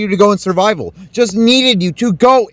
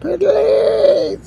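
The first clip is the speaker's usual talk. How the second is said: mock crying plea; 3 semitones higher than usual.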